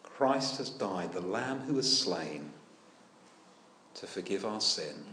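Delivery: usual speaking voice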